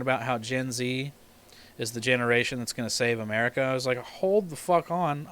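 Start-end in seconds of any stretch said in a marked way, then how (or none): 4.0-5.3 slow, raised-pitch protest; indignant objection. Retold or imitated. Objecting to Gen Z saving America, indignant. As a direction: draw it out to halt them in disbelief. Retold objection to TikTok claim.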